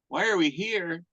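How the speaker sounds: "Why are we here?" pitched high in mock disbelief; high, astonished repeat of the question; incredulous echo.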